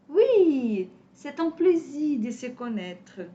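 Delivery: lilting, carefully spaced language-class dialogue; model dialogue reading. Shown as a demonstration. Teacher reading a beginners' French dialogue to students, lilting and clear.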